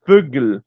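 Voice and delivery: Vögel with an F sound, "Fögel"; German word. Shown as a demonstration. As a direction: say "Vögel" starting with F. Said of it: "É um som de um F".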